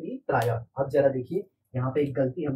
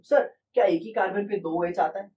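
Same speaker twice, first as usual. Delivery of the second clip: a pupil's higher, brighter question to sir; curious student voice; 5 semitones higher, 3 dB louder than usual. Pretend. Teacher acting a student asking sir about two OH groups. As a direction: play the puzzled student raising his hand.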